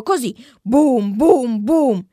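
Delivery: slow loud booms like a drum. A narrator's reading. Boy shows Lori how loudly she drummed, booming. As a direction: boom it out like a big bongo.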